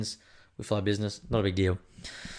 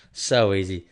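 louder, punchy repeat; emphatic; 2 semitones lower, 5 dB louder, slower than usual. Acted. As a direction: hit it hard the second time. Repeats the phrase, then loud.

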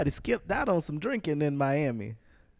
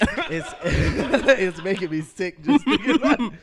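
laughing; 5 semitones higher, 5 dB louder than usual. Real, not acted. Laughing at thought of drinking.

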